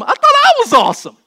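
very high, loud comic outburst; hammed-up wounded husband yelling; mock indignant shout. Husband answers wife's praise, mock indignant shout.

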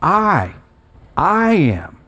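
"I" drawn out alone, a pause, then "I am"; emphatic I. Shown as a demonstration. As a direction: isolate and stretch the word "I". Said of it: "it's the emphatic use of".

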